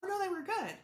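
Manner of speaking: high, put-on offhand voice; dismissive mock voice. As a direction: brush it off in a squeaky mock tone. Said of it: "It's a".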